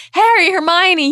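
high, loud excited yell. A narrator's reading. Ron yelling to his friends after the match, loud and high. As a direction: call out to them at full pitch. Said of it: "yelled Ron".

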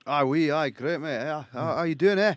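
hammed-up Scots hello; put-on Scottish accent. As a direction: greet him in a playful Scottish accent. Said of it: "So that's [name]'s, um, Scottish accent".

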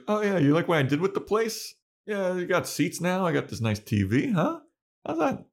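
showing-off Spike. Retold or imitated. Spike showing Buffy his redecorated crypt, high and eager. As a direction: play him proud of his new furniture. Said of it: "He's like".

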